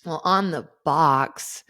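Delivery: ordinary talk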